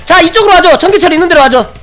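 shouting raid commands, high and loud; yelling across the room. Real, not acted. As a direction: bark orders over the battle. Raid leader calling out commands.

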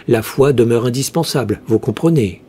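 ordinary delivery, nothing marked

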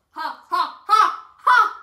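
loud, rising fake ha-ha; taunting laugh at a friend; hammed-up laughter; mock laugh. Mocking a friend after a joke.

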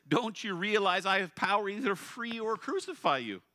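loud, high, threatening ruler's question; proud Pilate. Acted. Preacher voicing Pilate threatening Jesus, loud and high. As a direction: lean over him with your power.

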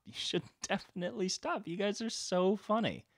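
high-pitched mock amusement; amused, scolding God. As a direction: chide them in a high, tickled voice.

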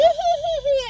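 a high, loud burst of real laughter. Real, not acted. Telling the viewers about her childhood go-kart fear, laughing hard. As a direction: crack up at the memory.

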